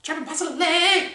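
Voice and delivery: high, slow sing-song chant; Dana Carvey. Pretend. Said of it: "Hashtag Dana Carvey?".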